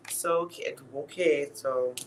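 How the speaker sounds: stammering over "okay"; stuttering. Real, not acted. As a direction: trip over the words, stuttering. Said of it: "I'm stuttering".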